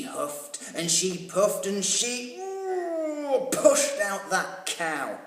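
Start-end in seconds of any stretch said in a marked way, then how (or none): 2.4-3.5 high effortful ooh; straining ooh. A narrator's reading. Old lady pushing the cow out, high straining ooh.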